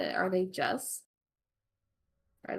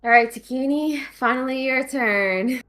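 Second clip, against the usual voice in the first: loud, higher calling out; 6 semitones higher, 11 dB louder than usual. Real, not acted. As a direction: call the next player over the table. GM calls next player.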